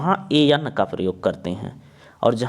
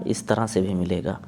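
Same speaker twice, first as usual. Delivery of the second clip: ordinary talk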